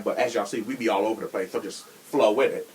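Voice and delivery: ordinary talk, nothing marked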